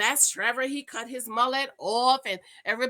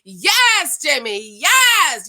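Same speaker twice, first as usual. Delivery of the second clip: high, loud drawn-out yeses; excited cheering; 4 semitones higher, 8 dB louder, much slower than usual. Real, not acted. Fan cheering a cast member's romantic line, elated. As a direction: cheer him on at the top of the voice.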